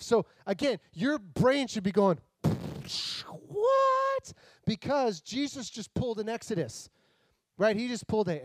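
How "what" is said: squeaky high disbelieving what; sudden shocked squeak of disbelief; stunned what. Pretend. Preacher voicing the listener's shocked brain, high squeak.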